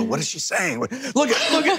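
high, panicky mock stammer; hammed-up frightened groom; shaking friend. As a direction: act out a scared groom pointing. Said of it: "he's shaking".

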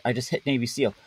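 normal talking voice

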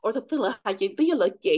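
ordinary talk, nothing marked

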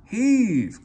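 loud high "heave"; loud, high called command; calling heave. A narrator's reading. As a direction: give the order to pull. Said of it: "called the Fat Controller".

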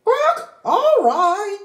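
slow, high resigned sigh of a hen. Acted. Henny Penny giving in to Ducky Lucky with a slow sigh. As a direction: give in with a sigh. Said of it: "sighed Henny Penny".

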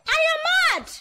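high, furious yell throwing the insult back; indignant cry of I am mad; furious shout. Acted. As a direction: shout it right back at him.

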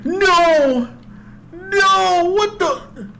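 high panicked yell; scared horror game streamer. Real, not acted. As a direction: scream no in terror. Deer attacks.

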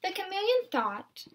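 gentle picture-book read-aloud; storybook narration for little ones; reading to children. Acted. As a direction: read it aloud to a class of young kids. Teacher reads picture book aloud.

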